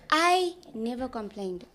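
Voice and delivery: ordinary talk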